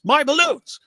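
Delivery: a quick failed try at the Joker's wail; Joker impression. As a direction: yelp it out high like the Joker. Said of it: "Wait, no, I can't do it".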